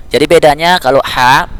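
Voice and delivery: ordinary talk, nothing marked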